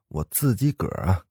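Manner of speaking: plain reading voice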